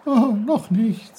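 high, loud, bubbly babbling voice; a merry stream calling out; cheerful brook. Acted. The brook greets Peter loudly and cheerfully.

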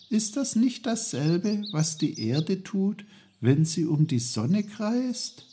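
put-on high-pitched, eager boy asking; Peter, eager child. Boy checks his idea with his uncle, eager. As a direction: ask as a boy who has just caught on.

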